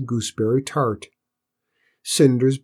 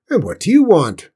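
high, loud cook's bark; loud raw bark; 6 semitones higher, 8 dB louder than usual. A narrator's reading. Cook challenges Cinders at the kitchen, barking. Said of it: "barked the fat, red-faced cook, her voice loud and raw".

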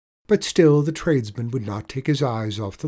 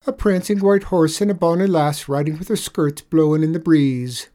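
higher, louder sly Irish voice; sly leprechaun; 4 semitones higher, 6 dB louder than usual. Acted. Leprechaun tricking the tradesman, sly higher voice. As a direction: wheedle him into looking away.

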